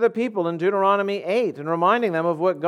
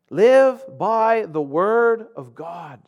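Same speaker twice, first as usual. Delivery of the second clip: slow exhortation; much slower than usual. Acted.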